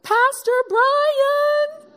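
long, high, loud sung-out call; yelled name. Retold or imitated. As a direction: call him from across the street. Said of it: "And I yelled".

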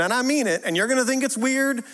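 ordinary talk

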